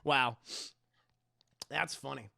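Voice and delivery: ordinary delivery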